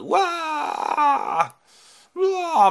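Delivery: high wailing giant cry; giant roar. Acted. The Cyclops howls while eating men, high wail. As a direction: bellow like a feasting monster. Giant man-eater munching sailors.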